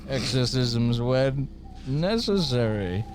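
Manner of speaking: mock-menacing proclamation; Bane. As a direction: build grandly, then sink to a slow finish. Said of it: "Has Bane got a job at the Vatican?".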